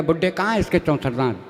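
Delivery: a hint of a sneering conductor; mocking conductor. Conductor mocks old man.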